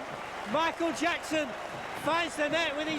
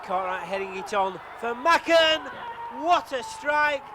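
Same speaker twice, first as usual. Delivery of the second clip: excited goal call; 4 dB louder than usual. Real, not acted. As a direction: let the strike land with a big shout. Commentator calls a goal.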